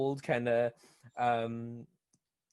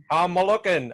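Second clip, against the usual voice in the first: higher, louder cheery voice; flattened caseworker voice; 6 semitones higher, 7 dB louder than usual. Retold or imitated. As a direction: play the squashed guy, bright and chipper. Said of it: "he's like".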